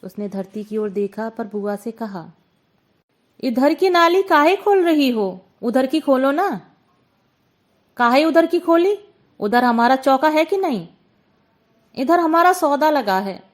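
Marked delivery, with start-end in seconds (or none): 3.4-6.7 raised, high-pitched complaint; indignant call upward; loud protest. A narrator's reading. Gulki protests to Bua about drain. 8.0-10.9 loud, combative comeback; quarrelsome retort. A narrator's reading. Bua argues back over the drain. 12.0-13.4 high, raised objection; aggrieved cry to the neighbour; loud protest. A narrator's reading. Gulki pleads her stall is there.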